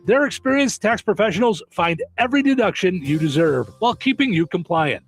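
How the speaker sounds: upbeat local radio ad voice; radio ad read. Acted. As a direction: sell it like a hometown radio spot. Reads a radio ad.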